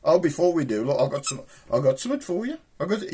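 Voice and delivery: ordinary talk, nothing marked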